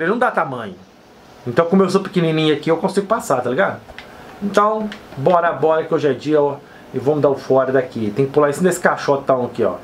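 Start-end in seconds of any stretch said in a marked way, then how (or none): none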